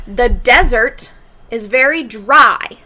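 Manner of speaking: "desert" said DEZ-ert, stress on the first syllable; teacher demo, "desert" as the noun. Shown as a demonstration. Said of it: "pronounced a little bit differently".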